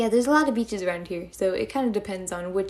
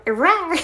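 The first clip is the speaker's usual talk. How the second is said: a sudden, much louder and higher cute roar; loud mock roar; 8 semitones higher, 9 dB louder than usual. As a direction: blast out one silly little roar. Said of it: "I don't know how to roar".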